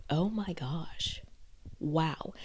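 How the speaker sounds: slow breathless whisper of amazement; awed whisper. Retold or imitated. Learning the actor is British, slow awed whisper. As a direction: hush it out in slow wonder. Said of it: "I was like".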